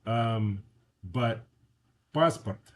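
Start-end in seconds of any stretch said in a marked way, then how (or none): 2.1-2.7 "passport" said the Russian way, PAHS-part; "passport" as Russian "PAHS-purt", tapped r; Russian pronunciation. Shown as a demonstration. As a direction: stress the first A of "passport", unstressed O as a short A. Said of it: "the first A is stressed".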